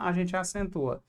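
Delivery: ordinary talk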